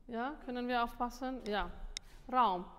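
normal talking voice

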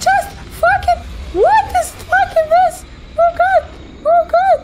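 high, loud panicked cries; panicked yelling. Real, not acted. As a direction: freak out in terror. Panicked after sudden scare.

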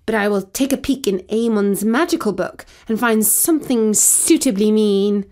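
ordinary delivery, nothing marked

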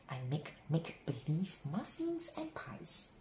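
ordinary delivery, nothing marked

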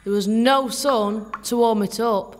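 ordinary delivery

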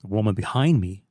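usual speaking voice

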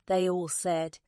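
ordinary delivery, nothing marked

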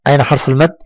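usual speaking voice

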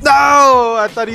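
loud high NO of outrage; angry shout. Real, not acted. Gamer shouting as he is killed, furious. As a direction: yell it as you die.

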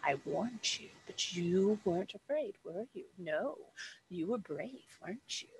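soft hissed taunting whisper; hissing woman's voice. Acted. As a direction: breathe it like a hissing snake woman. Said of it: "the woman's voice is still just like".

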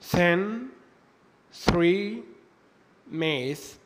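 "thin", "three", "myth" with th like Arabic ثاء; unvoiced th. Shown as a demonstration. Teacher giving examples of tha sound, "thin", "three", "myth" with unvoiced th. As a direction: model each word separately, "thin", "three", "myth", tongue between the teeth for unvoiced th. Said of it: "زي حرف الثاء في اللغة العربية".

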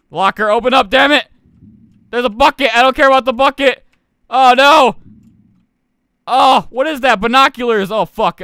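loud, high panicked shouting; frantic yelling. Real, not acted. Gamer fighting a locker in the shipwreck, frantic shouting. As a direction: yell urgently as time runs out.

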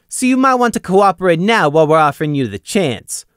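a confident interrogator laying down terms; Gloom, firm conviction. A narrator's reading. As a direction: press him firmly, sure of the outcome. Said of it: "Gloom said with conviction".

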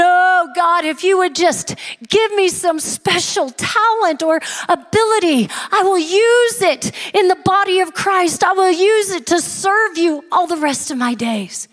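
high, swooping mock plea; crying out to God. Man promises God to serve with his talent, hammed-up pleading. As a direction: plead up high, stretching the key words. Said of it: "he's crying out to God".

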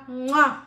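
loud smacking kiss; blown kiss. Real, not acted. Host signing off to viewers with a loud kiss. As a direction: blow a big kiss to the camera.

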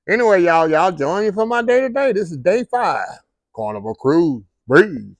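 loud, high, pumped-up greeting to the camera; hyped vlog intro. Real, not acted. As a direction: fire up the viewers like a show host.

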